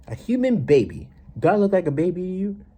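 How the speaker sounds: high, loud and offended; indignant outburst. Real, not acted.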